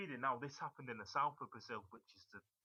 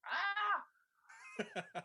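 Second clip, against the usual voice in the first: a sudden, much higher, louder squeal; 5 dB louder than usual. Real, not acted. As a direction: yelp out in playful frustration. Frustrated at losing the accent.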